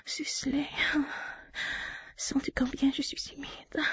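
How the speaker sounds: hushed, close to the mic; intimate whisper. Acted. To her partner, guiding him in a whisper.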